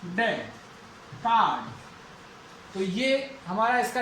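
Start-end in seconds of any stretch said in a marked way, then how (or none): 1.1-1.7 कार्ड as "kaad", falling in pitch; silent R. Shown as a demonstration. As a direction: drop the R in कार्ड, long "aa" then D. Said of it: "तो आर का उच्चारण नहीं होगा". (कार्ड, बिना र के, "काड")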